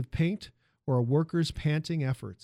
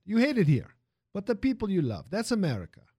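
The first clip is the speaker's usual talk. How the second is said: put-on lively Italian accent, raised pitch; 6 semitones higher, 4 dB louder than usual. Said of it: "the music of the man's accent".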